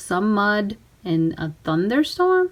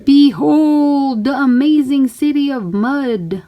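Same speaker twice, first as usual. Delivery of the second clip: grand announcement; 4 semitones higher, 6 dB louder than usual. Acted. Showing off mud city.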